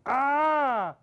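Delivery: high strained cry of agony; pained cry. Retold or imitated. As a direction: let out a cartoon howl of pain. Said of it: "this poor, decent human being goes".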